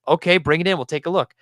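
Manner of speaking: ordinary talk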